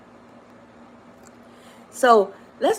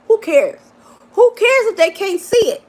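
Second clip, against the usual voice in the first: emphatic outburst; 11 semitones higher, 6 dB louder than usual. Real, not acted.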